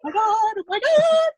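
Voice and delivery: high, loud, drawn-out fan freak-out; imitated loud yelling. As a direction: mimic a fan screaming over a celebrity. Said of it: "He, like, yelled so loud".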